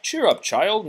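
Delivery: high, quick, motherly; kindly teapot voice. Acted. Mrs Potts offering Belle tea in kind teapot voice. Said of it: "Missus Potts said".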